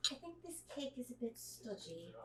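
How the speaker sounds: softly crushing critique; stern baking judge. Retold or imitated. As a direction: deliver the verdict quietly, like a disappointed judge. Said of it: "they're just like".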